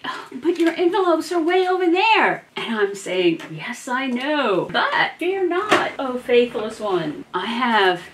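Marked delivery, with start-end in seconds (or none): 0.0-2.4 mock viewer, higher and louder; imitating a viewer. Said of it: "and you think". 4.7-7.2 hammed-up solemn reassurance, high and slow; mock grandeur. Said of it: "mock grand proclamation".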